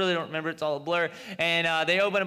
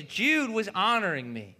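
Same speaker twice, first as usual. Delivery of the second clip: usual speaking voice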